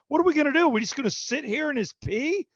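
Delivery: high-pitched, mock-outraged complaint; indignant father-in-law. Father-in-law to everyone about sitting in pee, mock outrage. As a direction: imitate an exasperated in-law, pitch shooting up.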